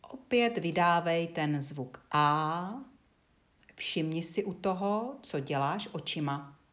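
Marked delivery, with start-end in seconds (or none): none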